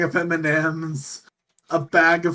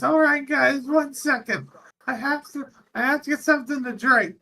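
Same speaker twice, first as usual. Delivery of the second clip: high, put-on voice of a clumsy pilot; 9 semitones higher than usual. Pilot asking astronauts to wait for a drink, high goofy voice. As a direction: play the dopey pilot, pitched way up. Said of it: "He goes".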